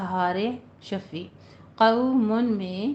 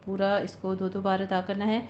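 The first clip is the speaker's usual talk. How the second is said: ordinary talk, nothing marked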